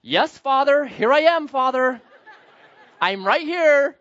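high, loud mock-dutiful child; hammed-up good son answering; obedient child. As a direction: play a prim, obedient little boy. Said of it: "he's not gonna be like".

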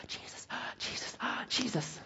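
breathless, gasping Jesus, Jesus; mock panting. Preacher mocks listeners breathing in Jesus, breathless gasps. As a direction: pretend to hyperventilate on each Jesus.

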